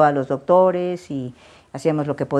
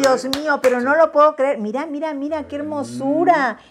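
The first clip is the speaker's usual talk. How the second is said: delighted surprise; 11 semitones higher than usual. Real, not acted. Reacts to surprise caricature gift.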